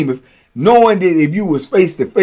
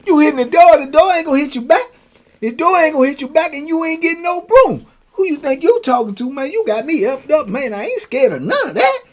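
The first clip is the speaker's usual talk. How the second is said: higher, fast slick taunt; slick taunting houseman; 11 semitones higher than usual. Retold or imitated. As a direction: needle the big guy from behind the door. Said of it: "So he said".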